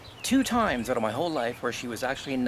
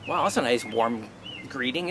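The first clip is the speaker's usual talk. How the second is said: ordinary talk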